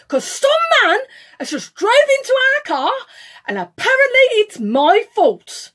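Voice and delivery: high, loud, aggrieved announcement; pointed indignant complaint. Retold or imitated. Phoning Liam in front of the driver, loudly indignant. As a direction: complain loudly so others overhear. Retold phone call within his earshot.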